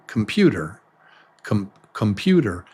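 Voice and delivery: "computer" quick and low as kum-PYOO-der; relaxed "computer", falling at the end; natural reduced vowels. Shown as a demonstration. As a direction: shrink the first vowel of "computer" to uh. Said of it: "if you say every vowel fully".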